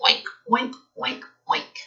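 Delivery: high mock oink, oink that fades out; pig oink. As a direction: squeal out a little pig oink. Reading animal noises to children.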